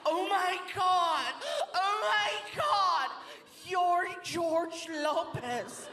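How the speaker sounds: high, drawn-out starstruck squeal; mock freak-out. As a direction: imitate a fan losing it, high and slow. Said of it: "She freaked out".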